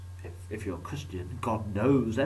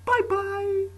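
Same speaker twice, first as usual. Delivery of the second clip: much higher, louder squeal; squeaky bye; 8 dB louder than usual. Acted. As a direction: squeal the goodbye very high. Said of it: "why I said that like that".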